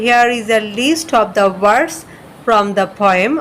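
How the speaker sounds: slow, careful dictation; slow read-aloud. Shown as a demonstration. Teacher reading the list heading to students, slowly. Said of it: "আমি লিখেছি এরকম যে".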